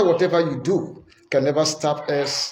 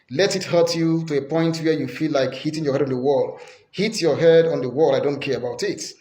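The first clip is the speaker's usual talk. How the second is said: ordinary delivery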